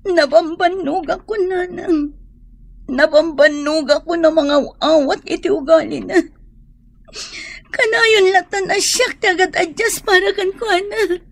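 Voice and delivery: tearful. Acted. Daughter tells mother of heartbreak.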